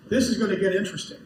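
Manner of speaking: amused at the coming family drama; laughing. Real, not acted. Telling the listener about the patient's new girlfriend, laughing. Chuckling at his own prediction.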